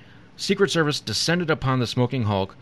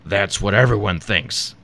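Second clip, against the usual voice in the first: Satan voice; 2 semitones lower, 4 dB louder than usual. Acted. Satan snickering at Lucifer, slow and sly. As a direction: relish it slowly, like a sly devil. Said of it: "Good Satan voice".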